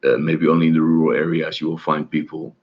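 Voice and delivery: normal talking voice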